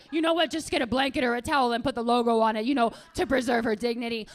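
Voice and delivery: brash, loud public advice; mother announcing it to the store; loud mom. Acted. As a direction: say it loudly with no discretion. Mother loudly advising the store clerk.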